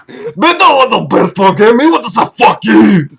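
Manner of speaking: garbled, bad drunk Scottish brogue; hammed-up Irish-Scottish drunk accent. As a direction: slur it like a drunken Scotsman. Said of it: "It's more Scottish".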